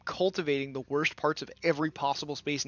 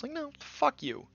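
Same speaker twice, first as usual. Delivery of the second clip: exasperated dismissal; 10 semitones higher, 4 dB quieter than usual. Real, not acted. Rejecting the imagined excuse.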